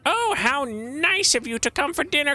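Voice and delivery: high, loud hammed-up witch. Witch taunts the trapped player, high and hammy. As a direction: gloat like a witch licking her lips. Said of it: "The witch remarks".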